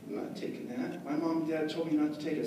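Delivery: put-on soft, whispered scared kid; timid refusal. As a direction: shrink away and refuse.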